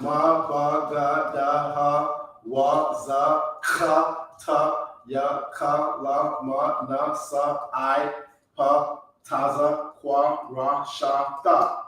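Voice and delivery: steady run of ma, ba, ga, da, one by one; teacher chanting the Hebrew syllables; syllable drill. Shown as a demonstration. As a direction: recite the sounds like a drill. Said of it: "get used to these sounds".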